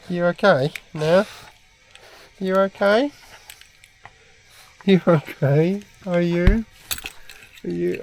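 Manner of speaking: high sing-song voice for a pet; talking to a dog. Real, not acted.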